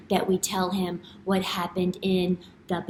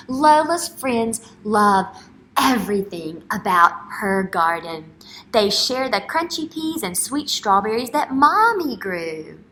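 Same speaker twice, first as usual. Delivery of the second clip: put-on reading to children; 5 dB louder than usual. Teacher reading a picture book aloud to young children, storybook voice. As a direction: read it out big for the class.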